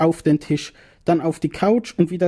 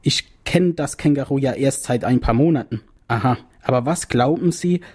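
usual speaking voice

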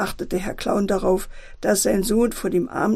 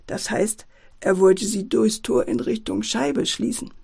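usual speaking voice

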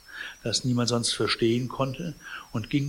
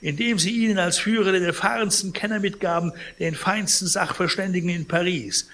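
raised, high, outraged voice; indignant; 7 semitones higher, 4 dB louder than usual. A narrator's reading. Sick man rails at his heirs' choice of expert, indignant. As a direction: keep the outrage going, pitched up. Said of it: "rief Pons empört".

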